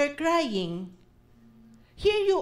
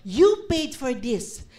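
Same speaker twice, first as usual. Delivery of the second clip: louder, a declaring prayer that claims what is owed; bold claim prayer; 7 dB louder than usual. Shown as a demonstration. Preacher leading the church in a loud prayer of claiming. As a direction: pray it like you are taking back what is yours. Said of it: "ang sinasabi mo dapat".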